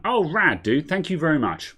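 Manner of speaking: high, delighted thanks; light up at the news; excited. Real, not acted. Thanks new Patreon supporter.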